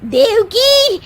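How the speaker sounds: imitating the judge. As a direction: proclaim it like a thrilled TV judge. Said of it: "जब जज साहब कहेंगे कि".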